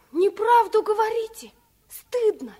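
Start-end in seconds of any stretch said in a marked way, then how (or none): 1.9-2.5 shamed whisper. Acted. Girl scolds guest for lying.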